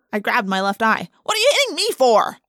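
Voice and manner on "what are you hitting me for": indignant yell. A narrator's reading. Just punched in the eye.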